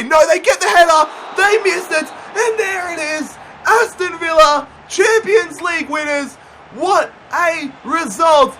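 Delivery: high loud cry of triumph; elated shouting; victory shout. Real, not acted. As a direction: roar it out as the title is won. Final whistle, title won.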